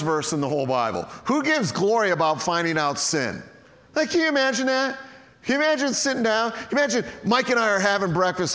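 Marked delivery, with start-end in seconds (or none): 3.9-8.5 fast, high and incredulous; incredulous excitement. Acted. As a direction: rattle it off in disbelief. Mocking an absurd verse.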